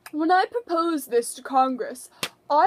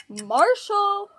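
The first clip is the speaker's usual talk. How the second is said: calling out; 7 semitones higher than usual. Acted. Calling Marshall a second time.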